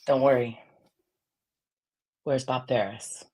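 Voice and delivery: usual speaking voice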